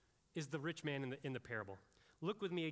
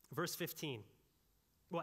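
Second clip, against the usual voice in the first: usual speaking voice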